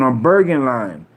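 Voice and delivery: usual speaking voice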